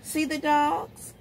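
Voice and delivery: plain reading voice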